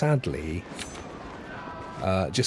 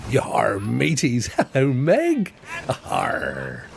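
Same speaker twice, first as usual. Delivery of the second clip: drawn-out Bristol pirate growl with a chuckle; hammed-up West Country pirate greeting; West Country pirate accent; much slower than usual. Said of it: "the accent, that, that accent, yar, comes from, uh, Bristol".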